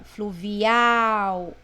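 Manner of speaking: Fluvial with a long, loud AL; stress on last syllable. Shown as a demonstration. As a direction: stretch and push the final syllable of Fluvial. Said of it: "última sílaba é forte". (Fluvial, alongando forte o final)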